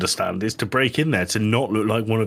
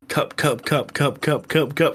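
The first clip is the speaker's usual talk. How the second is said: mock glitching voice; 5 semitones higher than usual. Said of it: "it'd go".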